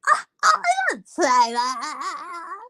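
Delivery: fake-cry the denial like a caught child; mock whiny crying denial. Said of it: "with the ugly face".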